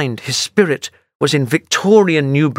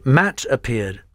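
usual speaking voice

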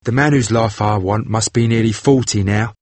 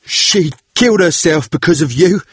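grim vengeful sailor voice; 7 semitones higher than usual. A narrator's reading. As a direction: spit out the long-held grudge. Vengeful brother threatens.

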